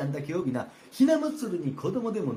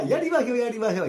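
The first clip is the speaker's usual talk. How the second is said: eager; 6 semitones higher, 6 dB louder than usual. Acted. Servant eagerly agrees.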